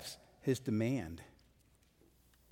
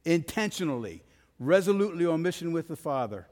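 loud, driving sermon build; forceful preaching; 5 semitones higher, 5 dB louder than usual. Acted.

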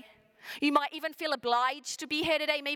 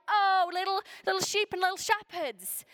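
mock cute cooing; 6 semitones higher than usual. Preacher mocking a cute nativity view, higher cooing. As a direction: coo it like a sentimental nativity card. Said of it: "it's not just this cute".

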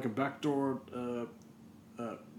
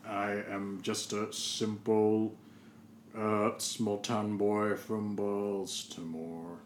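slow earnest; 4 dB louder, much slower than usual. Acted.

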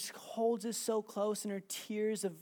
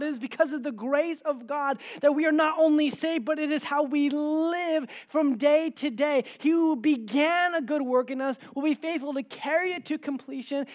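loud, raised, driving sermon delivery; impassioned preaching; 7 semitones higher, 10 dB louder than usual. Real, not acted. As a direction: urge the congregation on without letting up. Preaches at full force.

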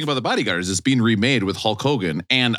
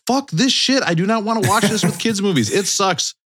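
exasperated outburst; 8 semitones higher than usual. Retold or imitated. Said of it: "I'm like".

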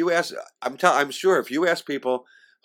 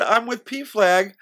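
much higher and very slow, each word laid out; slow pointed reply; 10 semitones higher, 3 dB louder, much slower than usual. Retold or imitated. Answering the classmate's hug, slow and much higher. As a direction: spell it out to the old classmate. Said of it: "I said".

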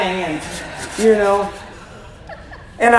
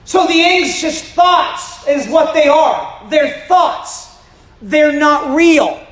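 raised, slow, emphatic sermon voice; preaching; 4 semitones higher, slower than usual. Acted. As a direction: drive it home to the congregation. Preaching to the congregation.

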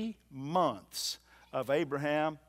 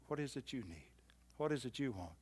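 normal talking voice

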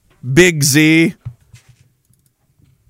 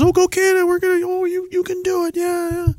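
much higher, fading soft mock cheering; mocking cheerleader; 13 semitones higher, 5 dB quieter than usual. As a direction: sneer at fair-weather fans. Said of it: "everybody's got Canadian flags".

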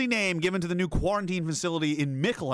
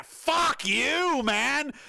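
angry outburst; 8 semitones higher, much slower than usual. Real, not acted. Raging at police on camera.

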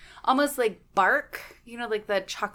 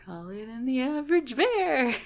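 Yogi Bear; 4 semitones higher than usual. Acted. Co-host asked for a cartoon impression, playful cartoon bear voice. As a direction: put on a cartoon bear, stretching the words. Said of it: "Can you do a Yogi Bear impression".

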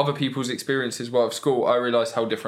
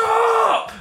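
high loud yell of stop; teacher shouting stop; 5 dB louder than usual. Retold or imitated. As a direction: bark it like an angry teacher. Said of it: "He was like".

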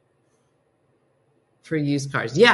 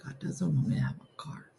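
hushed, breathless mock despair; despairing whisper; 6 semitones lower, 10 dB quieter than usual. Herself at the bank, whispering in mock despair. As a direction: whisper it like the universe is against you. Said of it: "I was like".

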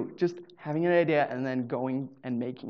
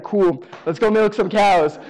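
slow, louder, cheery resignation; mock cheerful acceptance; 4 semitones higher, 8 dB louder, slower than usual. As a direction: act thrilled about milking cows. Mock happy acceptance of any job.

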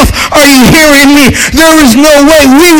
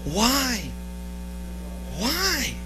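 soft intimate preaching; 4 semitones lower, 12 dB quieter than usual. Acted. Preacher asking the church why Jesus died, hushed. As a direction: drop to a hushed, gentle question. Asks congregation why, then answers.

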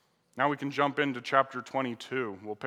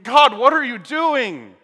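higher, much louder, slow anguished cry to God; 9 semitones higher, 14 dB louder, slower than usual. Acted. Preacher crying out to God for the sacrificed son, anguished loud slow cry. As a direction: drag it out in heartbroken protest.

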